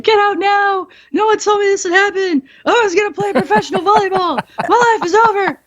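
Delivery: high-pitched fake wailing meltdown, voice way up; hammed-up sobbing panic to scare the new recruits; mock crying and screaming. Wheeling down the hallway, fake-crying and screaming to scare the students. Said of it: "I'm, like, crying and screaming".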